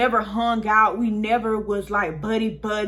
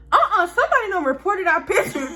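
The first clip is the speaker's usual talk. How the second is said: much higher, louder, laughing through it; imitating sister's outraged laugh; laughing disbelief; 10 semitones higher, 5 dB louder than usual. Sister shocked about reported picture.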